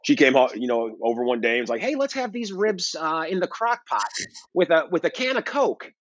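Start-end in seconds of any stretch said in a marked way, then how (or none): 1.8-5.9 impression of mom. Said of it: "is that how your mom really sounds?".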